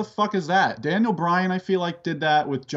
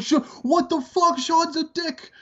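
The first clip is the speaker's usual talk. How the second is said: much higher, stunned outrage; shocked fan; 11 semitones higher than usual. Retold or imitated. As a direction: replay your own disbelief at the kick.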